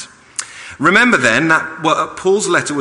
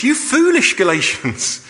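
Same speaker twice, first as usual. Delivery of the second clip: a hint of Paul scolding, higher; 11 semitones higher, 3 dB louder than usual. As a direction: chide them as the apostle would. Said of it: "He calls them foolish".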